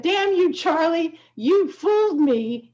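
high, playful mock scolding; a laughing "damn you" at a prankster; mock exasperation. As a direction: pretend to curse a friend who fooled you. Caught out by a prank call.

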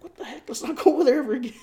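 put-on high, fast kid voice breaking into laughter; little brother's voice, laughing. Ten-year-old brother swearing off the place, high laughing voice. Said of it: "my brother's, like, ten".

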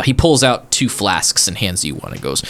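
normal talking voice

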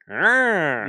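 sharp high growled curse; cursing growl. Retold or imitated. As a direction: snarl a hex at him. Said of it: "there is Danhausen cursing him".

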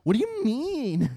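put-on high, loud, slow drunken whine; drunk slur. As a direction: drawl it like a sloppy drunk. Imitating drunk Kevin.

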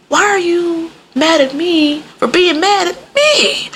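drawn-out, high-pitched indignant question; high, incredulous mock offense; offended. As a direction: voice someone taking it personally, slowly and high. Voicing offended people's reaction.

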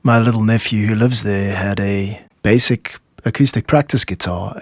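ordinary talk, nothing marked

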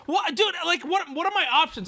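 high, loud, flustered defence; exasperated protest. Real, not acted. Streamer defending his choice of tattoo model, flustered and high. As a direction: protest it as if wrongly accused. Defends himself against chat teasing.

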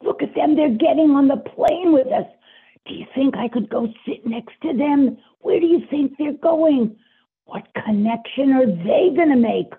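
put-on high, loud, thrilled kid voice; excited child. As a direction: point and squeal like a child. Little girl seeing aliens.

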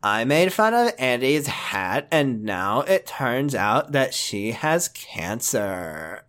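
low, slow narrator-style impression; Chills. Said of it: "perfecting your Chills impression for Hillary".

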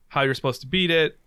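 ordinary talk

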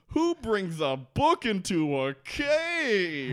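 mocking a dim character; Hurley impression. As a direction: drag it out like a baffled Hurley. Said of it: "And Hurley goes".

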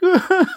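high-pitched pretend wail; badly faked child's crying sound; mock crying. Child crying incoherently to mom, high mock wail. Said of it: "just crying".

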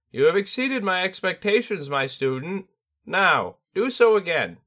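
high, loud bird spirit sensei; a lofty bird teacher, amused; Tengu voice. Acted. Tengu praises student.

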